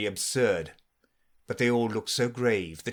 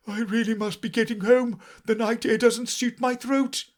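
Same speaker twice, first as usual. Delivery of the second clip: higher, fussy bird voice; old magpie; 12 semitones higher, 4 dB louder than usual. A narrator's reading. Magpie hurrying home from Alice, fussy. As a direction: excuse yourself hastily.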